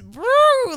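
high, loud rising woo; vocal whoosh for a sudden switch; swooping woo noise. Shown as a demonstration. Showing how sharply to change tones, swooping woo.

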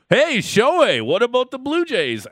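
loud, high, drawn-out mock call to Ohtani; hammed-up hollering at a star player; mock sales pitch. Host mocking the Jays calling Shohei, loud and high.

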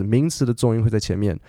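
usual speaking voice

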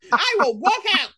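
imitating her fed-up self in the theater; exasperated demand. Acting out walking out of theater.

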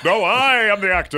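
hammy stage actor. Acted. Actor character insists.

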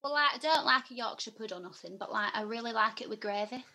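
broad Yorkshire accent on request. Shown as a demonstration. Asked by the interviewer, a Yorkshire accent. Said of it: "I want to know what your northern accent sounds like".

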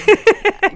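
loud, high burst of laughter. Real, not acted. Author laughs after saying how she feels, loud high laugh.

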